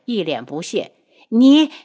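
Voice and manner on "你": high, loud, cut-off exclamation; indignant outburst. A narrator's reading. Empress dowager left speechless by the prince, outraged. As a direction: burst out loud and high, then stop short. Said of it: "太后被他噎得半天说不出话来".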